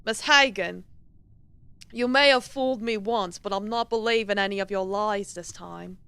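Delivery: stern, suspicious British professor; masculine British accent. Acted. Professor distrusts Miss Hagen's lies, stern masculine British accent. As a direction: accuse in a put-on British man's voice. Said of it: "masculine British accent".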